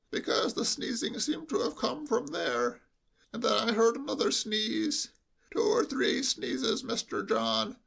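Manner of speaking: hushed, high sheep-like bleat. Acted. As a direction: bleat it confidentially, as through cotton wool. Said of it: "in a lowered voice".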